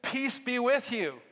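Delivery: "Peace be with you" high, loud and forceful; strong, emphatic. Shown as a demonstration. Preacher showing Jesus's strong greeting, emphatic on "Peace be with you". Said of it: "It's a strong statement".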